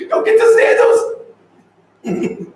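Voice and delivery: high pleading cry on "don't"; mock pleading. Viewer begging her mom about sandals, high squeal. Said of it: "She's like".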